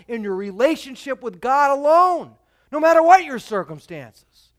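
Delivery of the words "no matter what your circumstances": ordinary delivery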